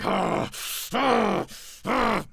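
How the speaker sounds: high, loud panicked cries; panicked shrieks. Acted. Raging Lucian shrieking in panic, high and loud. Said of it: "Lucian is like".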